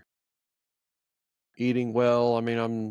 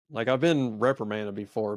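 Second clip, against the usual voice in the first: ordinary talk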